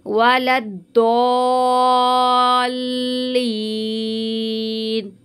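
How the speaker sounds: very slow वलद्दोल्लीन, the madd drawn long into the lam. Shown as a demonstration. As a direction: stretch वलद्दोल्लीन for five alif counts. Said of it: "किस तरह से मिलाएंगे देखिएगा". (पाँच अलिफ तक खींचकर वलद्दोल्लीन)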